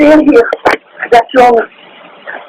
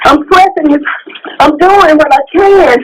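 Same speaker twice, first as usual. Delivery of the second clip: high-pitched tearful panic; real crying desperation; distraught, desperate; 7 semitones higher than usual. Real, not acted. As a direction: plead helplessly while straining to move someone, voice high. Struggling alone to give CPR.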